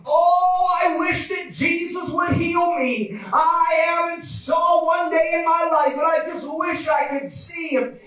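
high, drawn-out yearning cry; fervent longing. Acted. Blind man wishing Jesus would heal him, high fervent longing. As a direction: wish aloud with preacher's fervour.

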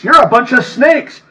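loud, high furious preacher voicing Jesus; fiery denunciation of the vipers; fired-up rebuke. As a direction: hurl the insult at them. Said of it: "he was fired up".

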